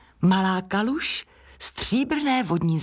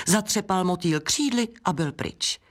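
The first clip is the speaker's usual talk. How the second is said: usual speaking voice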